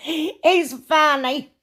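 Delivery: real high giggle, slowed by laughter; delighted laughter over a cute figurine; laughing. Real, not acted. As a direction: laugh through the words at something funny. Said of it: "he makes me laugh".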